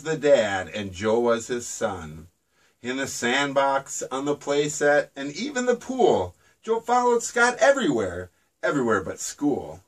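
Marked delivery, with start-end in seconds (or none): none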